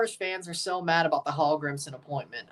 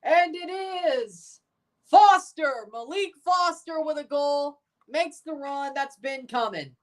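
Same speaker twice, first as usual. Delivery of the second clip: soaring, screamed goal call that fades as it lands; 9 semitones higher, slower than usual. Real, not acted. Streamer calling the goal live for chat, ecstatic high yell. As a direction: explode on the scorer's name, then let it settle.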